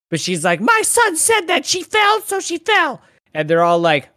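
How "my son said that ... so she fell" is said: high-pitched, hammed-up insistence; mock insistent mother. Mother brushing off the cops in her apartment, mock insistent. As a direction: mimic a mother stubbornly shutting down questions.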